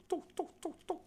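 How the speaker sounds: high, soft little tuks; tuk-tuk noise. Retold or imitated. Storyteller mimes Chelm people being a little odd, high soft tuks. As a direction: tick out small high tuk sounds.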